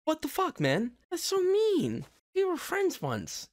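squeaky high mock-hurt whine; hammed-up high betrayed squeal; mock outrage. Mock hurt at friend's insult.

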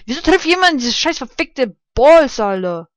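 loud high angry complaint; loud furious swearing; angry rant. Real, not acted. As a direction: vent your rage at the game. Raging at game.

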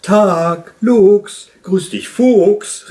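loud, high, drawn-out greeting; hailing someone from afar; calling out. A narrator's reading. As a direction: call across to them, stretching each name. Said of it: "rief der Wunschhase".

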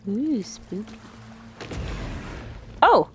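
normal talking voice

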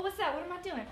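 normal talking voice

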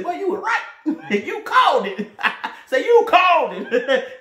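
pretend fan laughing. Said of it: "you better say".

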